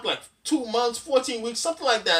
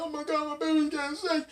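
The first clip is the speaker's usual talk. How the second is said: mock freaking out; 8 semitones higher, 3 dB quieter than usual. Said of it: "I was freaking out".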